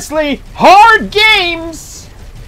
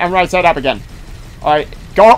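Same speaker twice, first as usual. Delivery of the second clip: normal talking voice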